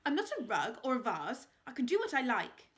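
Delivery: loud, indignant retort; wife insisting on her freedom. A narrator's reading. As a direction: bite back at him. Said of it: "snapped Lady Hastings".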